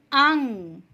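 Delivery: अंग said with the nasal held in the nose; नाक में रुकती ध्वनि से अंग; correct anusvara sound. Shown as a demonstration. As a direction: let अंग resonate and stop in the nose. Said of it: "इसका उच्चारण है अंग".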